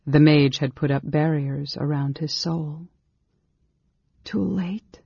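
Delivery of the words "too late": high, hushed despair; small voice of lost hope. Acted. Girl thinking the mage has shut her out, despairing. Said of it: "she thought in despair".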